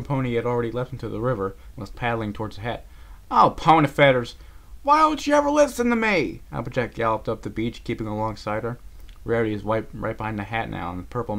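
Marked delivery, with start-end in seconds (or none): 3.3-6.4 loud frustrated outcry; fed-up scolding; exasperated. A narrator's reading. Applejack exasperated.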